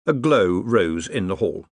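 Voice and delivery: usual speaking voice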